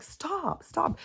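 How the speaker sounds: high squeaky stop stop; mimicking a clingy puppy's protest; imitating the puppy.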